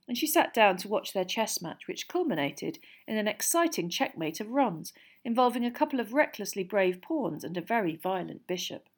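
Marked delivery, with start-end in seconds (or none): none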